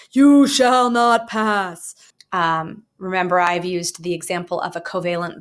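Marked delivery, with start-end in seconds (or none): 0.1-1.9 slow, booming wizard command; Gandalf impression. Teacher quoting Gandalf to the Balrog, booming. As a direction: boom it out slowly like Gandalf at the bridge. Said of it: "Gandalf ... he says".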